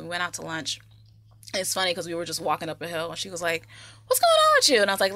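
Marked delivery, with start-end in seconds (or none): 4.1-4.8 very high-pitched, hyper mock voice. Imitating woman asking on walk up hill, hyper. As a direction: imitate an overeager friend way up high. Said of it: "like, hyper".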